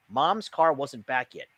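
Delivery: usual speaking voice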